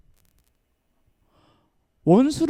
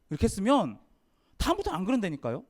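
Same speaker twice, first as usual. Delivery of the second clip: ordinary delivery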